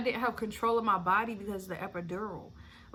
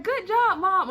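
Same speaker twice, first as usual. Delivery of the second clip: cheering husband; 10 semitones higher, 9 dB louder than usual. Retold or imitated. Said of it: "He was like".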